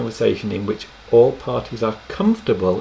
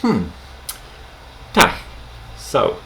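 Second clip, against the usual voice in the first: musing "Hmm, tak, so" before answering; buy time while thinking; pausing before an answer; thoughtful hesitation. Shown as a demonstration. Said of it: "you could say".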